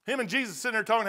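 normal talking voice

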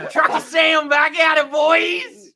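loud, high, drawn-out American cowboy holler; yelled cowboy American. Shown as a demonstration. Showing friends his American accent, yelling like a cowboy. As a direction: yell it as a hammed-up American cowboy. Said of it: "Do it normal without yelling".

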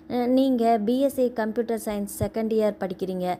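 plain reading voice

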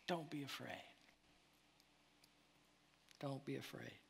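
softly repeating don't be afraid; tender whisper. Acted. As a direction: breathe it like a comfort at night. Jesus' comforting words, near silent.

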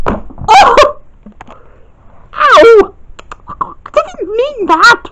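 high yelp then slow scolding; mock hurt, high; high pained girl voice. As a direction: cry out and complain.

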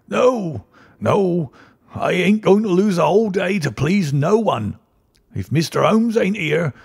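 put-on high, stubborn old man refusing; cranky old-timer digging in his heels. A narrator's reading.

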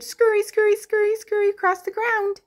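high, quick scurrying chant; scurry along in a high little voice; playful scurrying. Pretend. Teacher acting out a lizard for children, high playful scurry.

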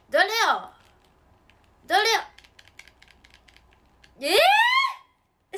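loud, high shouts building to a shriek; startled yells climbing into a piercing squeal; noisy shouting. Real, not acted. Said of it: "うるさい声を出してすいません".

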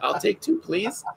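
a hint of a delighted stranger marveling; amazed adult. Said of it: "other adults are gonna be like".

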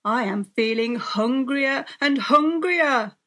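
big storybook villain roar; roaring wolf. A narrator's reading. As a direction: swell into a hungry roar. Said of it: "roared the wolf".

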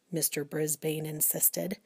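plain reading voice

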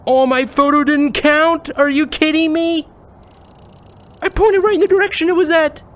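high, loud outrage; indignant, can't believe it; outraged. Real, not acted. Photo didn't count, outraged.

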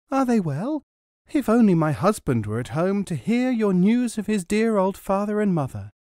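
high, eager questioning woman; eager village woman. Acted. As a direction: ask like a kind, simple wife.

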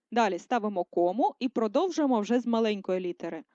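normal talking voice

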